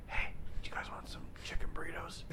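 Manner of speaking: acted whispered offer; whispering. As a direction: whisper it like the dorm. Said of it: "So I'd be like".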